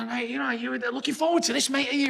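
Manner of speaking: chummy laddish question; imitating Will. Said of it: "He's like".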